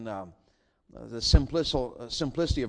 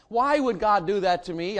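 bewildered cry; 6 semitones higher, 6 dB louder than usual. Retold or imitated. Preacher recalls his bewilderment.

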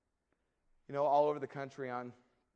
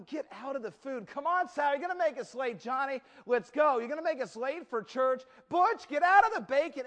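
high, loud, harried mother; flustered mom; 10 semitones higher, 8 dB louder than usual. Acted. Mom rushing kids and dog before church, flustered. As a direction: hurry the family along, building to a yell. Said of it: "mom starts to get a little bit flustered".

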